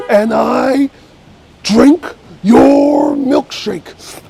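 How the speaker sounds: very slow, high, drawn-out menacing oil man; Daniel Day-Lewis. Acted. Performer doing the There Will Be Blood final scene, menacing drawl. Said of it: "There Will Be Blood I could do".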